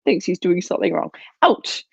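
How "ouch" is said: "Ouch" yelped very high and short. Shown as a demonstration. Trainer repeating the bite reaction for owners, high yelp. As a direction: give a sharp high yelp. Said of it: "turn your head".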